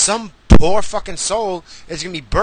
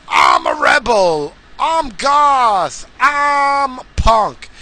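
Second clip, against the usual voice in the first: whiny mocking wannabe-punk voice, pitched up; mock poser voice; 7 semitones higher, 3 dB louder, much slower than usual. Ranting vlogger mocks wannabe punks in a whiny put-on voice. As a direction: sneer it like a poser bragging.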